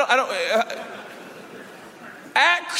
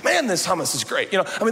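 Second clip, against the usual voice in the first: ordinary delivery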